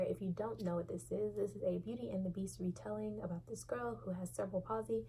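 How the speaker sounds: usual speaking voice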